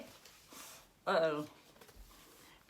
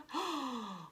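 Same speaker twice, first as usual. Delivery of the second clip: gasp; 6 semitones higher, 6 dB quieter than usual. Acted. Chicks fall into dye.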